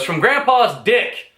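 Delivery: slow, high punchline; punchline delivery. Retold or imitated. Joke teller delivers the punchline slowly and high. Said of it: "telling the joke goes".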